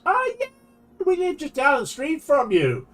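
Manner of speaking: high, cheerful country neighbor voice; hillbilly driver voice. Retold or imitated. Hillbilly driver cheerfully telling the kid they live nearby, high and friendly.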